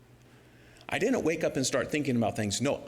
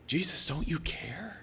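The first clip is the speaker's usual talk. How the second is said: subdued private plea. Retold or imitated. Preacher praying with nothing happening, subdued plea. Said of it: "And I feel like".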